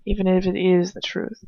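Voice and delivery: usual speaking voice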